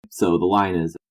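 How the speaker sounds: ordinary talk, nothing marked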